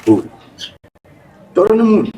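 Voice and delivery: usual speaking voice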